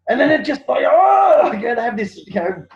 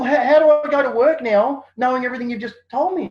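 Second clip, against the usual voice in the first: upset police officer; 8 semitones higher, 6 dB louder than usual. Retold or imitated. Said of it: "He goes".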